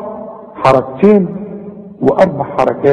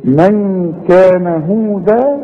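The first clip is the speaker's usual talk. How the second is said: slow Quranic recitation; measured tajweed reading; recite slowly; 4 semitones higher, much slower than usual. Shown as a demonstration. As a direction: draw out هودا slowly in recitation. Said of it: "هتقول إيه؟".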